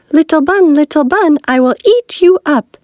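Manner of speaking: high, eager hungry hare; lightly voiced greedy hare. A narrator's reading. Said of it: "said the hare".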